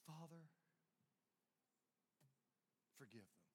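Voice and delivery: soft, low, fading prayer; hushed prayer. Retold or imitated. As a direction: drop to a near-hush, tender. Jesus' words after loud charge.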